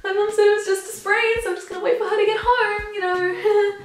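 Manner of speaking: very high, squeaky, strained brave front; mock tearful okay. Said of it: "Bawling my eyes out".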